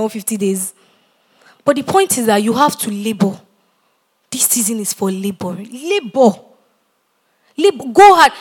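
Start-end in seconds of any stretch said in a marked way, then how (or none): 5.5-8.3 loud, punched-out commands rising to a shout; forceful exhortation. Real, not acted. Preacher urging the congregation to labor, forceful exhortation. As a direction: drive them on at full volume.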